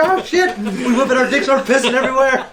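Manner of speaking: high, loud, cracking up; laughing mock panic. Storyteller reliving the coyote scare, laughing in mock panic. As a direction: re-enact panic while bursting into laughter.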